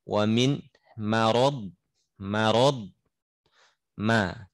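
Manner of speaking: ordinary talk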